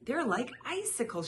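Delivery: Aunt Patsy exclaims over frozen paws; exclaiming. A narrator's reading. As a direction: gasp at how cold they are. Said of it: "she exclaimed".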